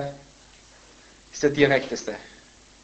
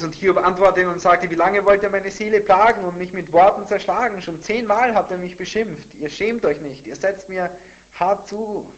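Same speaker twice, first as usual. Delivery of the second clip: raised, loud aggrieved protest; emotional scripture reading; 7 semitones higher, 9 dB louder than usual. Acted. Job protesting to friends who torment him, heated and raised. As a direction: declaim it higher, slowing on the last words. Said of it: "der Ton wird immer emotionaler".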